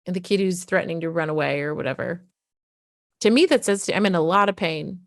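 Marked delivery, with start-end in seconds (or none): none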